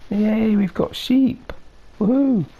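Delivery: high, slow squeal of delight; excited. Real, not acted. Delighted at getting sheep.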